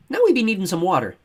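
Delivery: put-on high, loud childish chatter; excited boy Matt. Matt telling Kira and Thomas, excited. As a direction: rattle on like an eager little boy.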